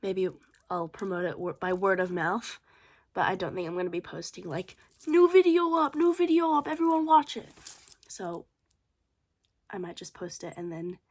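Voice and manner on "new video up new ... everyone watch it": loud, high, over-the-top promo shout; mock hype announcement. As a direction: announce it like a cheesy hype post.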